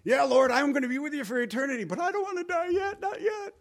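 high, fast, rising pleading voice; David's plea. Retold or imitated. Preacher voicing David bargaining not to die yet, high anxious squeak. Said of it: "this one is most people's size".

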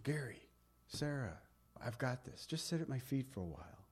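very soft, calming voice; hushed, tender, settling someone down; Jesus. Acted. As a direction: call each name gently and reassure. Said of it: "You'll hear him saying".

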